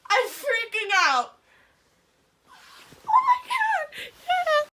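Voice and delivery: thrilled, voice cracking with joy; overjoyed squeal. Real, not acted. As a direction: squeal and choke up from sheer delight. Sees decorated room, overjoyed.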